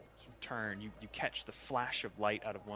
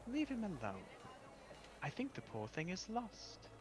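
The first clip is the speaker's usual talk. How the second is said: high, light voice, soothing the sprite; calm the fussy fairy, lightly voiced; gentle pool lady; 10 semitones higher, 5 dB quieter than usual. Water woman calming the sprite about the stranger, light and high.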